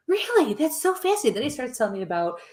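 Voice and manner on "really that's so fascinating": high, amazed officer gushing; mock fascination. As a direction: play the officer suddenly fascinated, pitched up.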